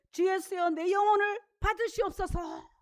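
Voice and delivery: fervent prayer. Acted. Stephen's dying prayer.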